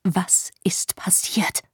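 slow, hushed whisper; quiet repeat. Acted. Man repeating his question to her, whispered. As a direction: ask it gently under the breath. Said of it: "Leise wiederholte er seine Frage".

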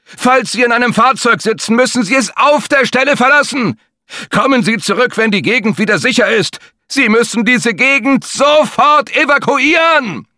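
high, loud barked commands through the street; police officer shouting orders at stalled drivers; urgent evacuation order. Acted. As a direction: order everyone out now, full force. Biker repeats the evacuation order.

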